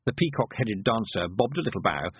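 plain reading voice

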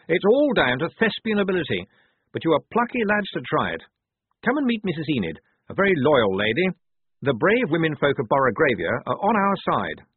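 lightly pompous, triumphant and encouraging. A narrator's reading. Blouse praises his lads and introduces Missus Enid, triumphant. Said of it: "said Blouse triumphantly".

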